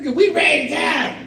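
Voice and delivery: put-on high, loud, whiny kid voice; loud laughing kid complaint; bored grandkids. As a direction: whine it like a fed-up kid.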